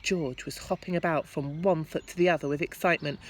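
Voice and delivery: ordinary delivery, nothing marked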